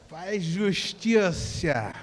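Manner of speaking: drawn-out Rio carioca accent. Shown as a demonstration. Preacher demonstrating Rio speech to the congregation, slow carioca accent. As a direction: stretch the vowels and hushed s like a carioca. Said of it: "Como o carioca fala".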